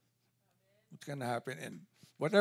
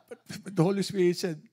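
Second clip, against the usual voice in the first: ordinary talk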